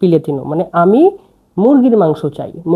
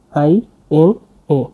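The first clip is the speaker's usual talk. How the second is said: slow spelling; 4 semitones lower, slower than usual. Shown as a demonstration. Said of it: "আমি এটা প্রতিটা ভেঙে ভেঙে দিলাম".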